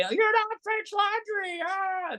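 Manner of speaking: high squealing voice. Retold or imitated. Owner mimicking a Yelp reviewer's complaint, high squealing voice. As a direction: squeal the complaint, drawn out into a whiny cry. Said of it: "in your high sque-, squealing voice".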